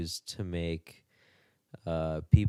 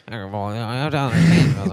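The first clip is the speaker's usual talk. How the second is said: loud, raised sneering mockery; mocking whiny voice; 4 semitones higher, 10 dB louder than usual. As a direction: put on a mocking whine. Mocking Shapiro's debate opponent.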